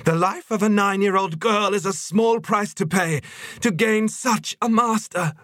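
very high, insistent creature voice; fervent zealot. Acted. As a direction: justify it zealously in a piping pitch. Voices fanatical Yetcha.

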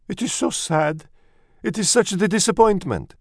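put-on very high, dramatic French-accented lament; sighing French mother. A narrator's reading. Mother mouse mourns her litter, high sighing disappointment. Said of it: "She sighed".

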